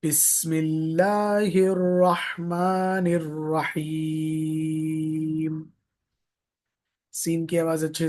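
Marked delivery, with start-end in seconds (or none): 0.0-5.7 drawn-out melodic tilawat, long held vowels; stretched chanted recitation; slow Quranic recitation. Shown as a demonstration. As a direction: recite slowly and melodiously like tajweed class. Teacher recites Quranic opening formulas.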